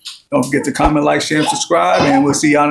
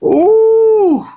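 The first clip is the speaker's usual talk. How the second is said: impressed ooh; 3 dB louder than usual. Real, not acted. Sees the dessert up close.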